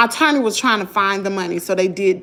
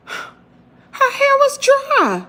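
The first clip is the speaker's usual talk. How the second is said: higher, louder, slow and emphatic, stunned; outraged disbelief; 4 dB louder, slower than usual. Real, not acted. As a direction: hammer the point she can't believe. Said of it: "outraged retelling".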